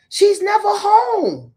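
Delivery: she's never home, high, slow and loud; exasperated slow-rising rant; emphatic outburst. Real, not acted. Ranting about Kandi's absence.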